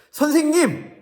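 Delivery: high, loud, begging call; pleading Pharisee. Acted. Pharisees calling out to Jesus, high pleading. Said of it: "바리새인들과 서기관들이 예수님께 말했어요".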